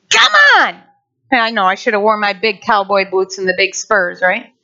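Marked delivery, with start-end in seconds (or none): 0.1-0.8 high, punched goading; spurring someone on. Acted. Preacher acts out spurring someone on, high goading. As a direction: spur them on with a high, forceful push. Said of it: "Sometimes it's a spurring".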